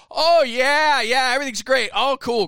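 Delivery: high, loud shouted reassurance; covering up the hurt. Retold or imitated. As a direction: call back as if nothing is wrong. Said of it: "act like I'm not hurt".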